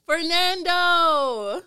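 drawn-out loud call of a name. Acted. Recalling a coworker, she calls his name out long and loud. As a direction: stretch the name out like hailing him across the store.

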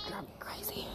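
exasperated whispered aside. Real, not acted. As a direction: hush it under your breath. Hiding complaint from kids.

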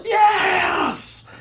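long, high, loud whooping yes; excited cheer. Retold or imitated. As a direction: stretch it out in a triumphant cry. Retold late-night gaming triumph.

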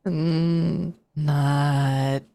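long, drawn-out windy words; slow, eerie raspy creature; raspy, windy shadow creature. Acted. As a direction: draw each word out like wind. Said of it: "you hear that long, raspy, windy voice".

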